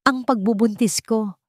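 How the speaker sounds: a touch of a shaking, bitter voice; trembling and bitter. Acted. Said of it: "Ang boses ay nanginginig ngunit puno ng kapaitan". (nanginginig pero mapait na boses)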